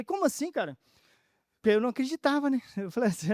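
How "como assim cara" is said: high-pitched retold disbelief; mildly acted incredulity; baffled question. Retold baffled reply to rescuer.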